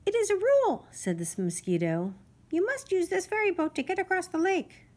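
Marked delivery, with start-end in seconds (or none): 0.1-0.9 high, louder little-insect voice, officious; fussy mosquito. Acted. Mosquito insists on the ferry rule, high and officious. 2.5-4.9 fussy mosquito. Acted. Mosquito insists on the ferry rule, high and officious.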